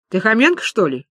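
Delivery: blunt, challenging question; rough query, dropping at the end; rude. Acted. Маша confronts the businessman, rudely. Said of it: "грубо спросила Маша".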